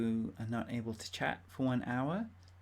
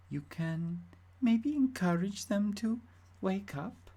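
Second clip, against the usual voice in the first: put-on higher-pitched, would-be Swedish accent; wandering Swedish accent; 4 semitones higher, 4 dB louder than usual. Game character asking you to wake the other residents, wandering Swedish accent. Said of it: "I don't know if I sound Swedish anymore".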